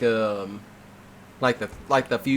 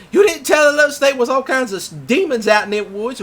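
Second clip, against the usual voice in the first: accusing complaint; 11 semitones higher, 5 dB louder than usual. Retold or imitated. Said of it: "they were like".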